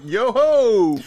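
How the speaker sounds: mock yo ho. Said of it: "You're like".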